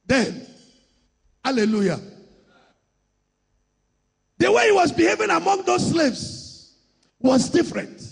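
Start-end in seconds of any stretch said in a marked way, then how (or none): 4.4-8.0 high, forceful pulpit delivery; impassioned preaching. Real, not acted. Preacher urging church to keep their identity, impassioned. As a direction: drive each phrase out high and strong.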